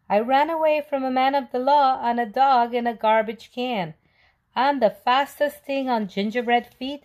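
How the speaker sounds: high, loud singsong taunt; taunting yell. Acted. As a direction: tease the chasers with a chant. Cookie taunting again.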